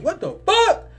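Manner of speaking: high, loud cry of disbelief; shocked yell. Retold or imitated. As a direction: jump up into an acted shocked shout. Acts out out-of-body shock.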